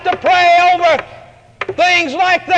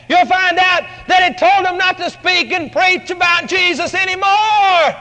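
usual speaking voice

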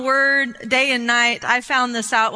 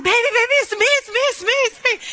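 high, urgent dad imitation; frantic reassurance; 12 semitones higher, faster than usual. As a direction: rush to calm a screaming child, voice pitched up. Dad calming his screaming daughter.

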